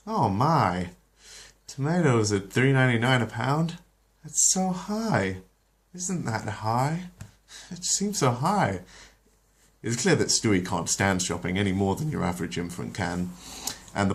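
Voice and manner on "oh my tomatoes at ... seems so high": high, slow, dithering mother voice; a fussing mom shocked by prices, slow and high; Lois voice. Acted. Lois at the tomatoes, dithering high and slow.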